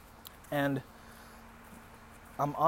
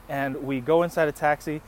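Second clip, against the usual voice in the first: ordinary talk, nothing marked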